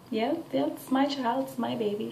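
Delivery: overly polite. Retold or imitated. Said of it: "So I very so politely just looked".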